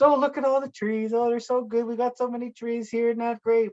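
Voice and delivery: high, gushing mock enthusiasm; talking to kids. Adults showing kids the trees, imitated in high delight. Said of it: "we say to kids".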